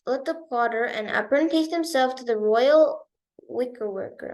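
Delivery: plain reading voice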